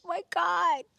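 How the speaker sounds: thrilled exclamation; excited squeal. Retold or imitated. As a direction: burst out in delight. Reacting to gorgeous guy.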